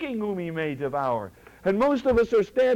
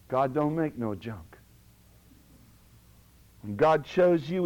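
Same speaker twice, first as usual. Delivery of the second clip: ordinary talk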